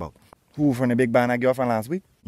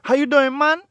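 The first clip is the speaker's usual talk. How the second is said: much higher, excited hello; excited greeting; 10 semitones higher, 5 dB louder than usual. Acted. Friend greets Jesus at the beach, excited. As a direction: greet him like an old friend.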